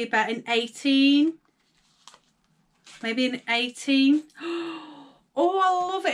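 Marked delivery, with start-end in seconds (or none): 4.4-6.1 higher gasp then gush; gasp, then love it; real delighted gasp. Real, not acted. Another gift opened.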